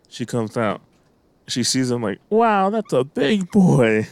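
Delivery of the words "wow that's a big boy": put-on high, drawn-out amazed kid line; little girl staring at a tall man, slow and higher; slow childlike wonder. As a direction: stretch it out like a wide-eyed child. Little girl's remark retold.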